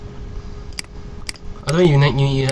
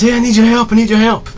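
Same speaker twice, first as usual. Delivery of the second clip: desperate caller; 8 semitones higher than usual. Pretend. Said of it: "they're getting mugged".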